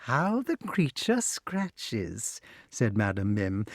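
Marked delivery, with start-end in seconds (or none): 0.0-2.4 gloating witch. A narrator's reading. Witch comments.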